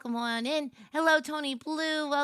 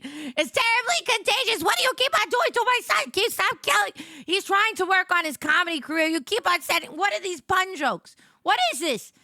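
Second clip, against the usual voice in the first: high-pitched scolding outburst; mock outrage; 6 semitones higher, 3 dB louder than usual. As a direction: scold them like an outraged parent, hammed up. Mock outrage at chatters' pun jokes.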